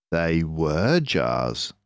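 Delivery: ordinary delivery, nothing marked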